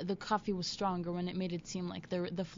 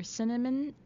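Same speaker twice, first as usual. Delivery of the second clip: ordinary talk, nothing marked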